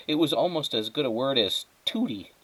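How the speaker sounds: usual speaking voice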